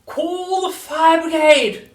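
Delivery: high, drawn-out screech of alarm; full-volume panicked grandma yell; sudden shout. A narrator's reading. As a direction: bellow it slowly in a shrieking old-woman voice. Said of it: "she shouted suddenly".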